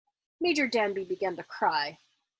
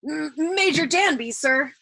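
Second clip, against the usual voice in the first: stammered; 6 semitones higher, 6 dB louder, slower than usual. A narrator's reading. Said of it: "Colonel Cathcart stammered".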